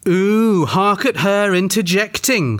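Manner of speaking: high, drawn-out catty mockery; bitchy. A narrator's reading. Peter mocks Janet for interjecting, bitchy. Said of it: "said Peter bitchily".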